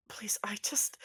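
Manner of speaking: soft, whispered pleading; hushed plea. Acted. Atticus pleads with the arresting officer, hushed. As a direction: plead almost without voice.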